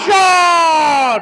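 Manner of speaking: sudden sharp shout; excited shout. Real, not acted. Live goal shot commentary.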